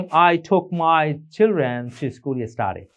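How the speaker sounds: each word laid out very slowly; very slow, clear model sentence; slow example sentence. Shown as a demonstration. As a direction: dictate it slowly for learners. Teacher reads example sentence.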